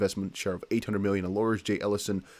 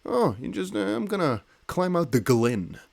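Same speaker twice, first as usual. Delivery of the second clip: higher, slow mock phrase; mock posh phrase; 8 semitones higher than usual. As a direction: try the word out as a joke. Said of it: "Is that a real thing that people use".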